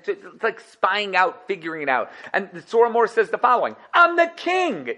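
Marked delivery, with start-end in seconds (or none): 3.9-4.9 much higher, proud royal declaration; hammed-up boastful king; Yosef proclaiming. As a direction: announce your rank grandly. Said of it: "said Yosef".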